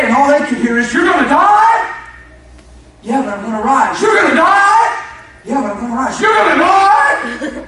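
loud, high shouted sermon with acted quotes; full-force preaching.

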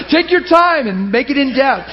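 laughing through the words; words spilling out through laughter. Real, not acted. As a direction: giggle while teasing her. Laughing through teasing reply.

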